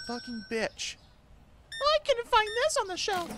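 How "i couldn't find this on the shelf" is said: very high whiny mimic of a customer; mocking customer voice. Streamer mimicking a whiny shopper's complaint, high mocking voice. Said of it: "you also bitched about the price".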